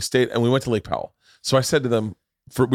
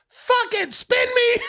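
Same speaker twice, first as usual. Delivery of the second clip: slow, very high-pitched imitated yell; old school Bobby; slower than usual. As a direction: give in with a drawn-out, hammed-up demand. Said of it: "realizing this is boring as shit".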